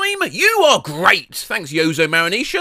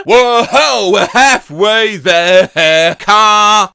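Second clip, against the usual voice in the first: loud; 9 dB louder, much slower than usual. Pretend. Mock car driving cry.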